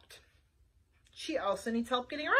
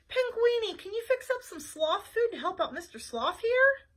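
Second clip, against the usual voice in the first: a lightly raised, eager detective voice; Flytrap voice; 8 semitones higher than usual. Acted. Detective asking Panguini for sloth food, eager. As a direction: ask the chef a favor, hopeful. Character voice.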